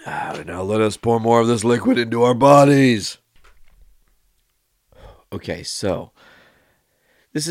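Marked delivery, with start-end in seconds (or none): 0.1-3.2 loud, high, pompous toast voice; grand mock toast. Host toasting listeners in a hammed-up, loud voice. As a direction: raise a glass like a hammy lord.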